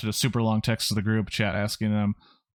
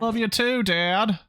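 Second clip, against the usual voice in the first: put-on high, girlish daughter voice; affectionate daughter; 12 semitones higher, slower than usual. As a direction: call back fondly to Dad, pitched way up. Acted daughter answers her father fondly.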